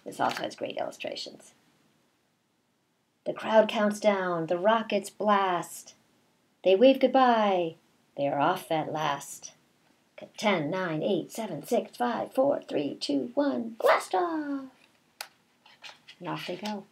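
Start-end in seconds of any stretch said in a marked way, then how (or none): 3.2-9.5 slow, sing-song read-aloud; reading to children. A narrator's reading. Teacher reading a picture book to her class, slow sing-song. 10.4-14.6 counting down higher and higher to a big blast off; excited countdown. Pretend. Teacher counting down the rocket launch for the class, building excitement.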